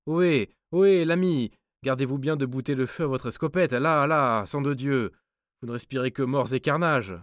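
high, loud, fast alarmed cry; dwarf's alarmed cry. A narrator's reading. Dwarf cries out to the armed guard, alarmed and high. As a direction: shout him down before he fires. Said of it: "s'écria le nain".

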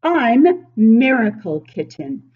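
ordinary talk, nothing marked

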